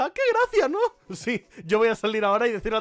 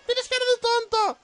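higher put-on taunt; mock captivating voice. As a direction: tease in a squeaky little voice. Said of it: "Voz cautivadora".

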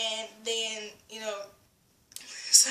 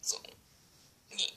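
ordinary talk, nothing marked

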